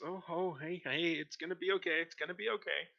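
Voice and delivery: put-on calm the child down gently; soothing guard. Guard calming a crying child: high, gentle coaxing.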